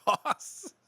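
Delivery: high put-on old-timer; grandpa voice. Co-host echoing the name, high grandpa voice. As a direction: say it as a comic grandpa, pitched high. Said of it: "That sounds like a grandpa".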